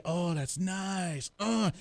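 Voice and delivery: soft, very slow contented sigh; blissful relief. Acted. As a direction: play melting into a massage chair, drawn out. Acts out enjoying seat massagers.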